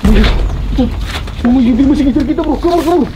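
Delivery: panicked high cries; being chased. Real, not acted. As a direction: run and yell in fear. Fleeing from golok holder. (panik dikejar)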